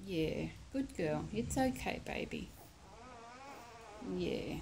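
soft reassuring words to a dog; calm her down gently; soothing a scared pet. Real, not acted. Calming the sore mother dog.

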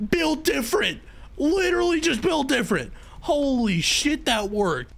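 high-pitched, excited disbelief; elated relief. Real, not acted. Player who just survived Foxy, crowing in excited relief. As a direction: crow it high, amazed to survive.

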